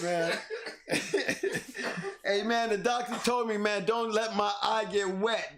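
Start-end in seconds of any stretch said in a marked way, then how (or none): 0.4-2.2 breathy high-pitched laugh, no voice. Real, not acted. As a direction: let a wheezing laugh slip out. Bursting out laughing at a joke.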